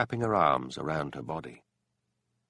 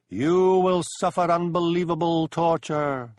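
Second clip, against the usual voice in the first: high, loud, slow ghostly taunt; mocking unearthly voice; 9 semitones higher, 7 dB louder than usual. A narrator's reading. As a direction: toy with him like a spirit promising doom. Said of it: "mocked the unearthly voice".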